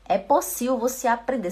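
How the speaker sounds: ordinary talk, nothing marked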